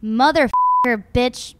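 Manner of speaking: loud, laughing string of swears; cursing like a sailor. Pretend. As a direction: blurt out curses playfully. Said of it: "Curse like a sailor for ten seconds".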